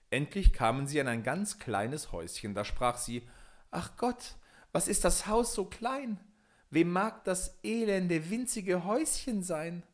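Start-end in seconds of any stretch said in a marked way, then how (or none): 3.7-9.8 high, slow, appalled complaint; spoiled princess recoiling at the hovel; dismayed princess. Acted. Entsetzte Prinzessin vor Häuschen. (hohe, entsetzte, verwöhnte Klage)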